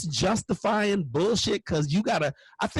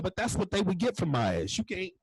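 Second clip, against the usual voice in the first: ordinary delivery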